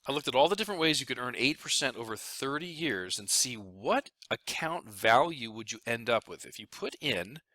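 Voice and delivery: ordinary talk, nothing marked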